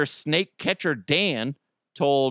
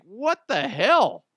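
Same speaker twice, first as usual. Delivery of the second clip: higher, drawn-out bewilderment; startled disbelief; 9 semitones higher, 4 dB louder, slower than usual. Retold or imitated. Teller spotting a drone watching him, startled disbelief. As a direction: stretch it out, pitched up in mock alarm. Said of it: "And I'm like".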